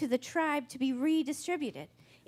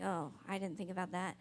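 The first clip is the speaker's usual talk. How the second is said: imitating Moses, low and soft; imagined weary sigh; 6 semitones lower, 9 dB quieter, faster than usual. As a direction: let it drop with a mock sigh. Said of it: "probably sighs and was like".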